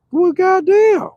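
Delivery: high put-on voice imitating a grumpy local; mock Detroit local, high voice. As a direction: squeal it like a shocked stranger. Mock stranger voice.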